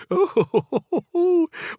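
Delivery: put-on high, delighted old lady titter; flattered giggle. Old woman laughs at compliment.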